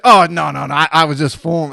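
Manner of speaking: loud, hasty mock denial; caught-out bluffer covering up; retold backpedalling. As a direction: protest loudly that it was a joke.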